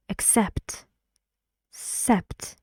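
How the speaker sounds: "Accept" with the weight on "cept"; light "Ac", strong "cept" in "Accept"; stress on second syllable. Shown as a demonstration. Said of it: "Where's the stress?".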